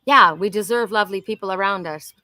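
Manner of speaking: normal talking voice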